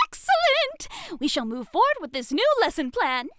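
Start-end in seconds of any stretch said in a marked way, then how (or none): 0.1-1.2 high, slow, gleeful excellent; delighted. Acted. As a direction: savor the approval. Teacher approving.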